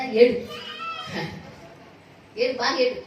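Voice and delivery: loud jeering cry; mock taunt. Neighbours jeering at a crying woman, loud mock taunt. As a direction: taunt them loudly, as mean neighbours would. Mocking someone who cries.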